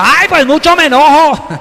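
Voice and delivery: high, loud petulant whine; whiny sulking Jonah. Acted. Jonah talking back to God, high loud whine.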